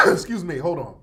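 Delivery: coughing while talking; throat choked up, coughing; choking on a drink. Real, not acted. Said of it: "Choking and shit".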